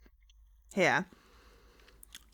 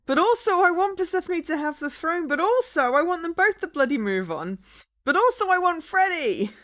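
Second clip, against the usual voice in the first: louder, higher, torn and exasperated; excited frustration; 8 semitones higher, 5 dB louder than usual. Real, not acted. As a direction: build up in exasperated outbursts. Torn between choices, exclaiming.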